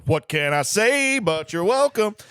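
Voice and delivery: big, high, drawn-out showman delivery; Samoan celebrity impression. Co-host showing one of his two Samoan impressions, loud and showy. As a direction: belt it slowly as a Samoan star impression. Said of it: "so the impressions for those".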